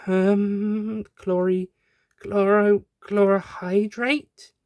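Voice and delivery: high, halting, slow and confused; drugged captive trying the word; groggy Doctor. Acted. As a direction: stumble through the word, dazed.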